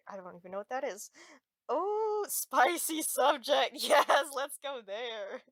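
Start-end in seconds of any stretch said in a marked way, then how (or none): 1.7-5.4 giggly. Acted. Giggling over lingerie store.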